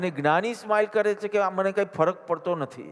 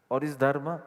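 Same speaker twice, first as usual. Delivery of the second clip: ordinary delivery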